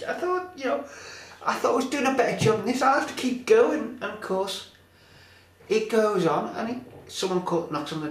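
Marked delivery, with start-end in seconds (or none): 0.1-4.0 high-pitched put-upon grumbling; raised, exasperated comic complaint; mock exasperation. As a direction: act out a boyfriend going up the wall. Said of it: "he's going up the wall".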